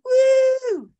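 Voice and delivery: high, loud drawn-out woo; cheering whoop. Retold or imitated. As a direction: whoop like an excited fan in the crowd. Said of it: "they start fucking going".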